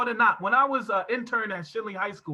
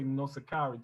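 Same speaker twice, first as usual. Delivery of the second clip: slowed-down name; 7 semitones lower, 7 dB quieter than usual. Shown as a demonstration. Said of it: "I kinda slow it down".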